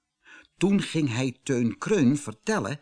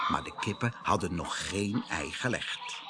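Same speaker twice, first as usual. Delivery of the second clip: plain reading voice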